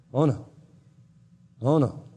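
usual speaking voice

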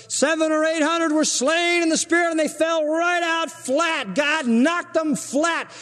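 high, hammed-up revival preacher, slowing at the end; preacher at a big rally, mocked by the teacher, hammed-up and high. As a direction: ham up a TV preacher whipping up the crowd. Imitating a preacher working crowd.

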